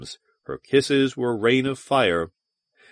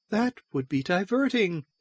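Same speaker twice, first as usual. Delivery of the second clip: high, softer alien temptress; Valduma; 8 semitones higher, 5 dB quieter than usual. A narrator's reading. Valduma after her maniac laughter, softly amused. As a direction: musical high voice, wickedly amused.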